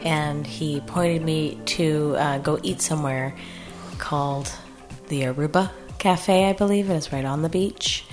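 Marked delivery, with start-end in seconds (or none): none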